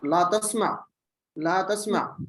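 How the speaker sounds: ला तस्मा closed without a final u; measured model of ला तस्मा, vowelless ain at the end; corrective final jazm. Shown as a demonstration. Teacher correcting a student's ending, final jazm. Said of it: "आइन के ऊपर जज़म है".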